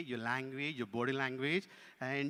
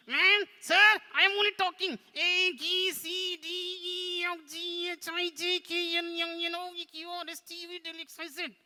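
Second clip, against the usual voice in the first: high puppet recitation; show-off puppet; 9 dB louder than usual. Pretend. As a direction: prove yourself with a high-voiced puppet alphabet. Puppet recites to prove it talks.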